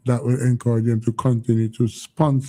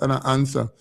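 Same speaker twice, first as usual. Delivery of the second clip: usual speaking voice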